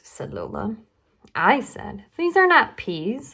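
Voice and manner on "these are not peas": higher and louder, teasing; playful fib. Acted. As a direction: announce it like a magic secret. Charlie tricks Lola.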